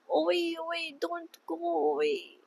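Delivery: put-on soft little toddler plea; lightly voiced toddler. Baby brother begging not to leave, lightly voiced. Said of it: "And he says".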